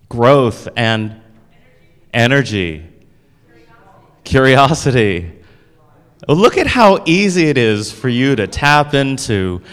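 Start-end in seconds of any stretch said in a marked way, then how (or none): none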